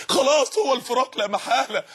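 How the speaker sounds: usual speaking voice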